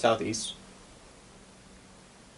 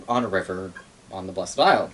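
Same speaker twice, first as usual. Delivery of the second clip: ordinary talk, nothing marked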